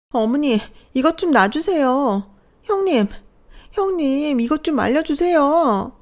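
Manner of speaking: high, frantic plea for help; daughter-in-law shrieking for her sister-in-law to intervene; panicked screaming. Acted. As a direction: beg while your hair is being pulled. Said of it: "소리를 질렀어요".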